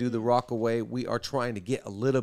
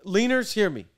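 loud, high-pitched hype, fast; high energy hype; 7 semitones higher, 8 dB louder than usual. Acted. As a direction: pump up the crowd. Hypes the audience about energy.

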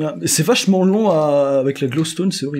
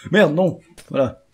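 ordinary delivery

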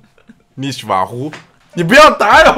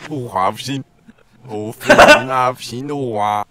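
backwards speech; 7 semitones lower, 3 dB louder than usual. Acted. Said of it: "你听我这个倒放". (倒放腔)

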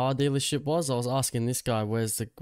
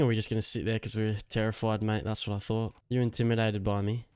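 ordinary talk

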